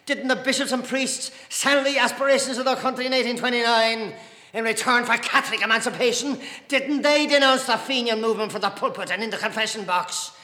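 loud, high volley of accusing questions; angry tirade. A narrator's reading. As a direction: hammer each accusation home in anger. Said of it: "His face was glowing with anger".

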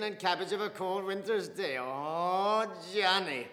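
loud, high, laughing ridicule; mocking father. Father ridiculing a priest to Mr Casey, mocking laughter. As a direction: savour the mockery of the fat priest.

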